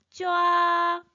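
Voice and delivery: 쫙 held long on one high, level pitch; first tone. Shown as a demonstration. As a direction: draw 쫙 out high and even, like underlining. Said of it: "일성으로 읽어주세요". (쫙을 높고 평평하게 길게, 일성으로)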